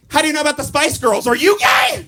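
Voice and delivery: rising to an indignant yell; mock indignant shout. Teen snapping back at his buddy, mock outraged. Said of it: "then I'm like".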